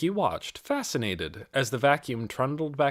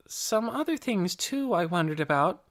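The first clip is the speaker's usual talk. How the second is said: a hint of a girl's higher voice; lightly voiced young girl; 8 semitones higher than usual. A narrator's reading. Nicky answers Mrs. Beeson, lightly voiced as a girl. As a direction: lightly voice Nicky, eager and polite.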